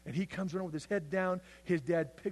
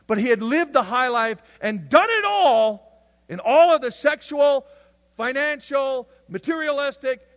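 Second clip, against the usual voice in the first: higher and louder, building preacher's cry; preaching at full force; 7 semitones higher, 10 dB louder than usual. Acted. Preacher crying out about the son's high life, loud. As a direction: drive it home to the congregation.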